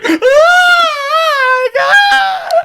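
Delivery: very high, loud wailing shriek; imitated screaming. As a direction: shriek like a hysterical child, hammed up. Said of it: "I was screaming".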